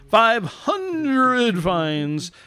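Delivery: drawn-out proclamation; milestone announcer. Pretend. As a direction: stretch out the number for effect.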